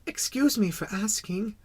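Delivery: put-on high, small child voice; tentative venture. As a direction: politely beg pardon as the little prince. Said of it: "he ventured".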